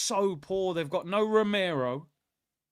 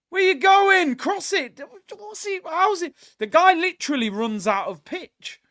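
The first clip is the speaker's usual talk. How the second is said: loud, high, urgent shouts at the telly; screaming for him to shoot; excited yelling; 12 semitones higher, 9 dB louder than usual. Real, not acted. Shouting at the match live.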